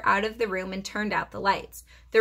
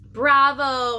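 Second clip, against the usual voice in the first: squeaked bravo; 3 semitones higher, 8 dB louder than usual. A narrator's reading. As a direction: cheer like a tiny hamster. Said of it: "I squeaked".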